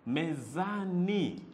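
MEZANI drawn out syllable by syllable; slow, separated syllables on "Mezani"; slow word demonstration. Shown as a demonstration. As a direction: say "Mezani" slowly for learners. Teacher sounding out new word.

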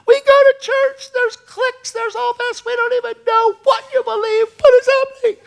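loud, shrieking high imitated crying; hysterical wail. Sister-in-law despairing about church, hysterical wail. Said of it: "She lost it".

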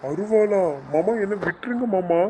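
high, panicky begging; alarmed pleading. Acted. Nephew begs uncle to spare him, frightened. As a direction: plead for your life, pitch jumping up.

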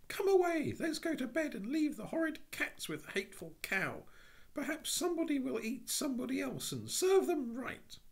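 high, wailing tearful cry; a frightened little girl bursting into tears. A narrator's reading. Jane bursting into tears over the cow, wailing high. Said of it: "she cried".

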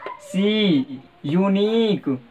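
सी and यूनिक with a drawn-out long ee, said slowly; teacher drawing out the long ee; modelling long ee. Shown as a demonstration. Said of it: "ई की sound होगी".

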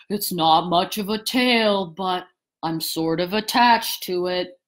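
low, gloomy donkey voice. A narrator's reading. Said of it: "looked at it gloomily".

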